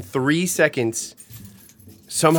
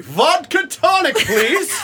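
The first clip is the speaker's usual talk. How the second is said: mocking a friend's bar order, building to a loud, slow, high shout on the drink; mock bartender-speak order; 13 semitones higher, 4 dB louder, much slower than usual. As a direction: imitate her: casual, then bark the order loud and high. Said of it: "I never say I'm a bartender".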